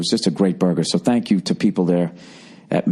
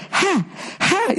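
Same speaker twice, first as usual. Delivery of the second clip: high, sharp hot-mouth gasps; burned-mouth panting. Retold or imitated. Friend bit hot cheese, high gasping pants. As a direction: imitate a friend huffing at scalding cheese. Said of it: "And he's going".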